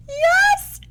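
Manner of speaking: a thrilled high yelp; quiet scream. Acted. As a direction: squeal it out but keep it hushed. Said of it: "Joan quietly screamed".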